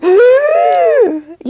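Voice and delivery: high, drawn-out wordless squeak; mock reaction squeal. Reacting to the cousins about the castle, high mock squeal.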